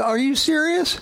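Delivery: a mock-incredulous onlooker scoffing; skeptical world. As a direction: play the baffled doubter, squeaky with disbelief. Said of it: "They go".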